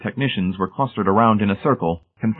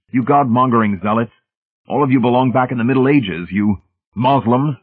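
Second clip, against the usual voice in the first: loud, higher-pitched denunciation; accusing; 5 semitones higher, 5 dB louder than usual. A narrator's reading. Hamilton denouncing the zealots, accusing. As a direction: hurl the insults, drawing out the last. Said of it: "he accused".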